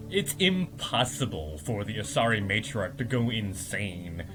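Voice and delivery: dismissive council. Retold or imitated. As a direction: drop the voice down and flatly refuse. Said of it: "and the councils are like".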